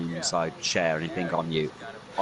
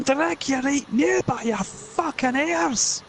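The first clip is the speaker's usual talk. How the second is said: high, slow, menacing Scots rant; bully Harry to school; Scottish threat; slower than usual. Acted.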